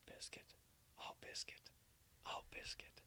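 breathy whisper of the dog's name, drawn out; whispered call. Acted. Host whispering the dog's name to the cohost, hushed. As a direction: whisper it like a secret summons.

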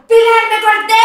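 high, loud cry for help; imitated alarmed shout. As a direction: call out hurt, in imitation. Retells injured boy calling her. (grito alarmado imitado)

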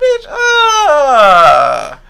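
high-pitched mock-furious wail; mad outburst. Podcast guest retelling being charged for an ID, mock-furious wail. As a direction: blow up in exaggerated, squealing outrage. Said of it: "I was mad as hell".

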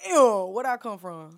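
slow, loud startled imitation. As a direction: react like hearing a stranger. Mocking deep phone voice.

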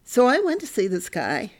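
normal talking voice

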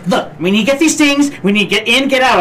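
put-on bossy girlfriend voice. Partner's hurried demands, acted quote.

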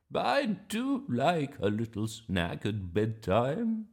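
slow, drawn-out grandma voice. Acted. Grandma excusing her bedtime sandwiches, slow and relishing. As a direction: savour it like an indulgent granny. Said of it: "she said".

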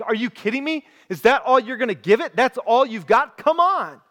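mock outrage, pitch jumping up high; angry. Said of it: "And Elisha gets angry".

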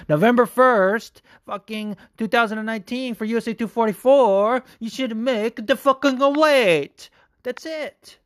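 high-pitched, slow, drawn-out insistence; exasperated rant. Real, not acted. Podcaster ranting about a fighter missing weight, exasperated. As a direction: hammer it home in a high, exasperated voice.

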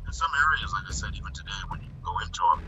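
thin, distant phone sound; like a bad phone line; tinny phone audio. Real, not acted. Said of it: "the audio just got, like, tinny".

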